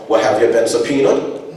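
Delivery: loud, high, fast demanding father; indignant grandfather. Retold or imitated. Grandfather scolding his son, loud and high. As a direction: push back hard at the son. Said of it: "father voiced".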